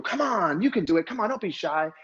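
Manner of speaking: high-pitched, pushy coaxing; mock hype-up, pitched well up; pushy cheering. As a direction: push a shy person to join in. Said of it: "the last thing you wanna do".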